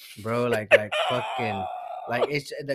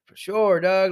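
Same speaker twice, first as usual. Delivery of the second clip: loud, higher emphatic agreement; retold hearty agreement; 6 semitones higher, 9 dB louder, slower than usual. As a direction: belt out agreement as in the retelling. Acts out agreeing with security guard.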